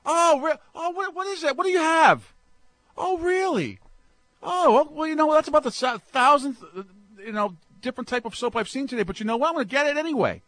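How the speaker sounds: high, lightly mocking delighted woman's voice; imitating a woman shopper. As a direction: play the shopper charmed by the pitch. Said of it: "women have a different way".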